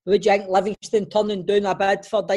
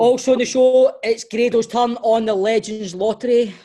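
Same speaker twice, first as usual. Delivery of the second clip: louder, slow announcer read; host intro; 2 semitones higher, 6 dB louder, slower than usual. Acted. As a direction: announce the segment like a presenter.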